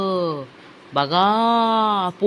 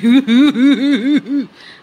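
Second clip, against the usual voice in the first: loud helpless laugh, close to tears; laughing till crying; 7 semitones higher, 9 dB louder than usual. Real, not acted. Vlogger mocks aunt's power cuts, helpless loud laughter. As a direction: burst out laughing, higher and louder. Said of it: "मी हसते का रडते मला समजत नाही".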